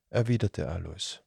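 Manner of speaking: ordinary delivery, nothing marked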